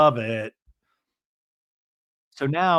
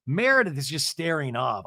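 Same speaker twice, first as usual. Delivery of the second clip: usual speaking voice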